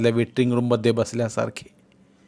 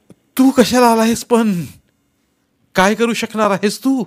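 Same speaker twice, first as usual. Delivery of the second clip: crying; 10 semitones higher, 7 dB louder than usual. Acted. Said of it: "बोलता बोलता वैभवराव रडायला लागले".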